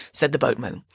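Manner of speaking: plain reading voice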